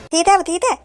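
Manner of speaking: high-pitched lisped agreement; lisping tutli. Acted. Lisping girl agreeing to sing for him, lisped. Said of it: "एक तोतली singer".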